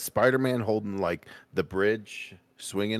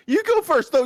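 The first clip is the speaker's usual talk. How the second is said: loud laughing; 9 dB louder than usual. Real, not acted. Laughing urging friend to start.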